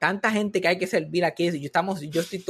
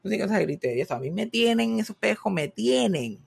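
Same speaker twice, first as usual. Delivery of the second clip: ordinary talk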